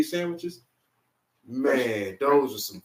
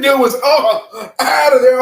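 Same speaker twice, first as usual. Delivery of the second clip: excited; 10 semitones higher, 11 dB louder, slower than usual. Retold or imitated. Excited retelling of knockout.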